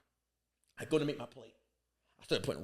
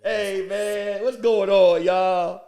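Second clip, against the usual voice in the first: tipsy partygoer; 7 dB louder, much slower than usual. Retold or imitated. Partygoer heckling.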